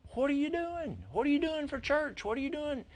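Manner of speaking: high-pitched, insistent retold questions; eager questioning. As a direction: pepper her with questions way up high. Retells eager questioning of the woman.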